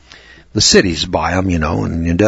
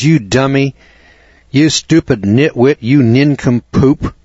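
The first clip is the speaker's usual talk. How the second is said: raised, drawn-out name-calling, lightly acted; mock upset wife; 4 semitones higher, 4 dB louder than usual. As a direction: imitate a wife scolding her foolish husband. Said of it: "she just was really upset about that".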